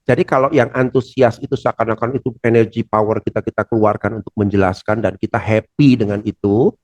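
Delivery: normal talking voice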